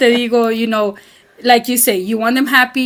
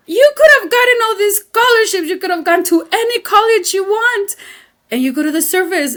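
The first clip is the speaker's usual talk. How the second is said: pitched higher, pleading disbelief; exasperated mom; 8 semitones higher than usual. Retold or imitated. Mother objecting to son joining military, higher exasperated pleading. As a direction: raise your voice like a baffled mother. Mother arguing with son's choice.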